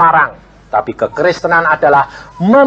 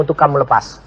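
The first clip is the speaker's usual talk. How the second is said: ordinary talk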